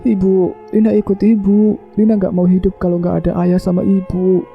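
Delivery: very high, desperate tearful pleading; acted wailing cry. A narrator's reading. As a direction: wail for your parents in a high voice. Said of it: "Lina berteriak sekencang mungkin". (meratap histeris dengan nada tinggi)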